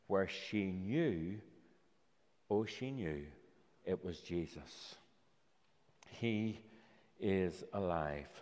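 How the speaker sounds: soft and low, slowed right down; hushed awe. A narrator's reading. Telling children the moment Mary knew Jesus was alive, hushed. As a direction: let the revelation land in a near hush. Climax of the Easter story.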